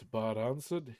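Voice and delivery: ordinary delivery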